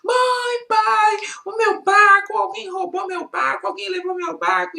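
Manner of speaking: put-on high, wailing child calling his parents; crying boy shouting for his mother and father. A narrator's reading. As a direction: sob it out, pitched high. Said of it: "chegou em casa chorando, gritando".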